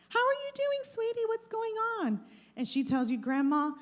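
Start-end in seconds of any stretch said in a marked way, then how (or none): 0.1-2.2 much higher, sugary grandma voice; sweet grandma. Retold or imitated. Grandma answering a sweet call. 3.2-3.7 "Grandma," spoken out clearly, not whispered; clear-voiced granddaughter. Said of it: "They are talking as clear as day".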